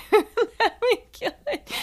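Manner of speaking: re-quoting her own line through real laughter; laughing. Real, not acted. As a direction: repeat the line cracking up at yourself. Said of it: "repeats it laughing".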